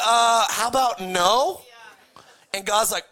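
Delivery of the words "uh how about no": slow, reluctant brush-off; hesitate, then flatly turn God down; reluctant refusal. Retold or imitated. Ananias stalling when God sends him. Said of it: "he's like".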